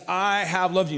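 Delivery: "I have loved you" with loved pressed hard and falling; emphatic. Acted. Preacher quoting God to the congregation, emphatic. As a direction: state it firmly as God, stress on loved. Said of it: "It's emphatic. It's a statement".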